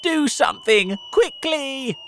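high, loud, urgent plea; desperate cry. A narrator's reading. Earthworm begs James as the peach falls, desperate cry. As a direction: plead loudly at a panicked high pitch. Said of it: "cried the earthworm".

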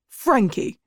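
a mild, narrated shout of a name; sudden shout. A narrator's reading. Teacher calls out an inattentive pupil, mild shout. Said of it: "the teacher shouted suddenly".

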